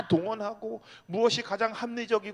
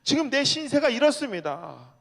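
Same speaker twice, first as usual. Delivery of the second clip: lamenting; 7 semitones higher, 5 dB louder than usual. Retold or imitated. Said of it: "신세 한탄하면서".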